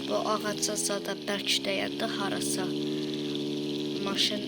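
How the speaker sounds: deep voice. Acted. Deep put-on voice.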